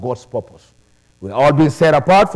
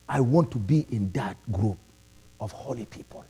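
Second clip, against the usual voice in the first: breathy whisper of holy people; whispered longing; 6 semitones lower, 10 dB quieter than usual. Real, not acted. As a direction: let it fall to a hushed whisper.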